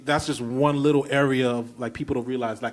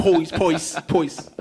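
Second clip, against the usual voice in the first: "poise" in a put-on Brooklyn accent; 3 semitones higher, 5 dB louder, much slower than usual. Shown as a demonstration. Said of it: "The Brooklyn".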